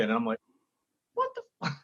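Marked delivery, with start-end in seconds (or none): 1.1-1.8 squeaky high, hushed disbelief; stunned whisper-yelp. Retold or imitated. Comic watching Gaffigan walk on, stunned hushed squeak. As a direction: gasp it out in stunned shock.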